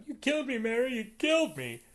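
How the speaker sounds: stabbed man's overblown cry at Mary, a loud high mock wail; hammed-up dying cry. Billy stabbed, throws Mary off.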